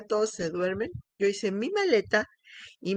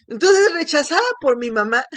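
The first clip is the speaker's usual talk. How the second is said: pained outburst; 5 semitones higher, 7 dB louder than usual. Real, not acted. Recalling painful rejection, voice rising.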